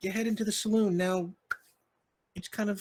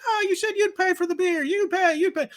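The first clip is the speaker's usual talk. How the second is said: much higher and louder, shoving the blame back; a fiddler yelling back at the piano player; angry retort; 6 dB louder than usual. Acted. Band members quarreling.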